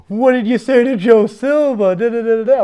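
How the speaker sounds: high, loud, frantic demand from the managers; alarmed managers. Retold or imitated. Managers phoning, alarmed about call.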